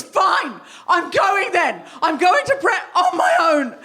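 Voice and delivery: retold huffy outburst; mock angry huff. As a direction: snap it out like a sulk. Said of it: "the way I said it was".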